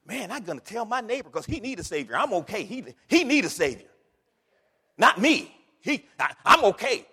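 mock self-satisfied brush-off; lightly voiced complacent churchgoer; smug dismissal. Preacher mocking people who say only the neighbor needs saving. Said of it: "response of most people".

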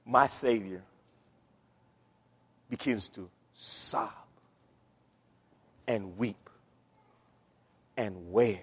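low, slow and soft, with long pauses; hushed solemn preaching. Acted. Preacher telling how Jesus wept over Jerusalem, hushed and grave. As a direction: let each phrase sink in quietly.

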